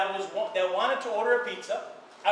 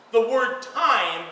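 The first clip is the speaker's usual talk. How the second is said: "time" stressed, slow and drawn out; emphasis on time; 4 dB louder, much slower than usual. Shown as a demonstration. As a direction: lean hard on "time", slowly. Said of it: "The emphasis on the word time".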